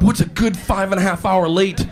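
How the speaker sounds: normal talking voice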